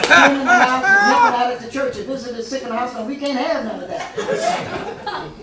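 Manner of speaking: loud, laughing parent's lecture, slowing at the end; mock scolding parent. Mock parent scolding Keisha, loud and laughing. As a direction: scold the kids while breaking up.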